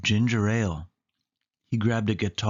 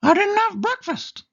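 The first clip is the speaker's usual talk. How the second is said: very high squeaky mouse voice; Mickey voice; 5 dB louder than usual. A narrator's reading. Mickey tells Minnie he skipped breakfast, high squeaky voice. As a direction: chirp it high like a cartoon mouse. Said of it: "said Mickey".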